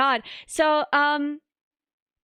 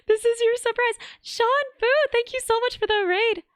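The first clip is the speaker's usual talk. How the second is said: excited thanks; 10 semitones higher than usual. Real, not acted. Streamer squealing thanks to a donor, very high. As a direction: squeal the donor's name with joy.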